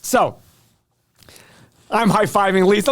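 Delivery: usual speaking voice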